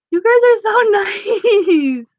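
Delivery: high, slow, giggling through it; touched, laughing at sweet comments; laughing thanks. Real, not acted. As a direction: gush with a laugh.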